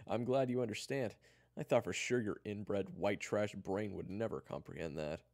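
loud, high gloating insult; mocking condescension. Eric insults Kyler after feigned apology, mocking. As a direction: deliver the insult with false cheer.

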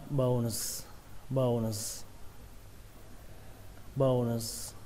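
"bones" ending in a long hissed s instead of z; final s. Shown as a demonstration. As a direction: close "bones" on an s sound. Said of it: "có thể đọc là âm sờ". (đọc "bones" kết thúc bằng âm sờ)

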